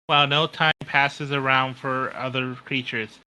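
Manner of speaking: usual speaking voice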